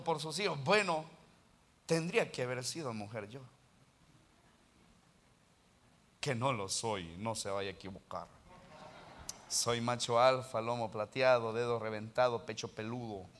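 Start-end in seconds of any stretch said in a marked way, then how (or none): 1.8-13.3 low, macho deadpan aside; jokingly gruff self-description, pitched well down; deadpan mock boast. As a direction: drop low for a tongue-in-cheek manly boast.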